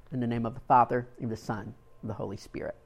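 fading, soft benediction; closing blessing. Real, not acted. Preacher ending the sermon with a blessing, fading softly. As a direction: bless them quietly to close.

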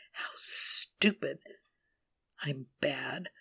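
slow bitter whisper. Acted. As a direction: hiss it slowly at yourself. Self-reproach about sharing diary.

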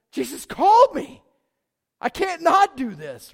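high, loud, emphatic quoted conviction; retold fervent declaration. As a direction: burst out as if finally confessing the real reason. Retold student's final heartfelt declaration.

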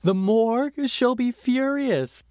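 hammed-up high panic of a husband scared of his wife; panicked husband. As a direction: squeal it like a henpecked husband in a panic. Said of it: "And he's like".

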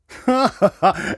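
loud high burst of laughter; hearty laugh. A narrator's reading. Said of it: "laughed Robbie".

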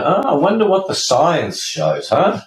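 lightly voiced inner thought; mock curious patient. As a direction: play a curious patient musing aloud. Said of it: "you can go".

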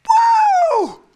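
sudden high, loud "woo"; piercing playful whoop; excited whoop. Retold or imitated. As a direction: whoop like a leaping baby. Imitates John leaping in the womb.

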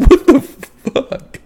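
high, slow, laughing curse. Real, not acted. Co-host still cracking up at the story, slow laughing curse. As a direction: drag it out in laughter.

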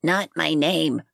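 a slow, mild growl, curt and cold; understated growl of a tiny woman refusing a nickname. Acted. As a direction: growl it slowly to shut him down. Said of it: "growled the woman".

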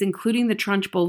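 plain reading voice

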